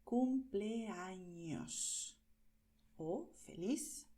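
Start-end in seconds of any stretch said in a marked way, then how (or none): 0.0-2.2 cumpleaños drawn out very slowly, clear ñ; very slow careful. Shown as a demonstration. Teacher modelling cumpleaños very slowly. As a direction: stretch every syllable of cumpleaños. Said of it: "Y tienes feliz".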